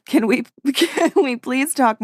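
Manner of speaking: can't keep a straight face on the plea; laughing. Real, not acted. Reading the houseguest's plea aloud to cohost, breaking into laughter.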